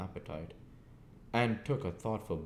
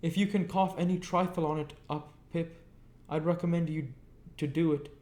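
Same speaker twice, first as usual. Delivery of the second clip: aghast; 11 semitones higher, 5 dB louder than usual. A narrator's reading. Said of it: "all aghast".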